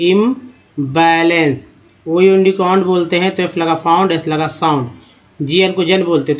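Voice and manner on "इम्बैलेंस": इम्बैलेंस slowly, leading with इम; slow demo. Shown as a demonstration. Teacher showing students the word, slowly sounded out. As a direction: ease through इम्बैलेंस part by part. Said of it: "ये IM को इम बोलते हैं".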